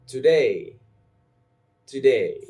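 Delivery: "today" as to-DAY, loud and falling; stress on second syllable. Shown as a demonstration. Teacher saying words for learners to repeat, second syllable stressed. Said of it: "I will say the word and you repeat".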